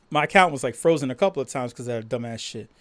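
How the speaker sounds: normal talking voice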